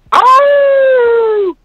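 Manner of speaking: high howling cry; wolf howl. Shown as a demonstration. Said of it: "some wolves howling".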